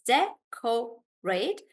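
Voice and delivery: "Decorate" drawn out slowly in three beats; slow syllable read. Shown as a demonstration. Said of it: "three syllables".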